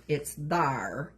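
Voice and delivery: "bar" said for "there", with a drawn-out R; Appalachian "bar" with a long R. Shown as a demonstration. Appalachian "bar" for there, explained to viewers. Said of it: "So instead of, like, there".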